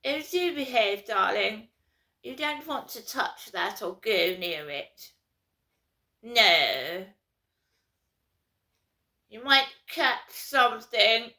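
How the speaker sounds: put-on loud, plummy telling-off of a child; posh parent scolding. Posh parent warning child off, loud mock scolding. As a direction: scold a child like a snooty mother. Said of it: "how posh people tell their children off".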